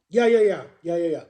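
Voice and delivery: ordinary delivery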